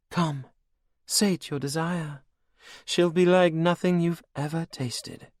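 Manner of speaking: high, cold and mocking; villainess queen. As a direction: purr it over your captive like a cruel queen. Anne tempts Logan.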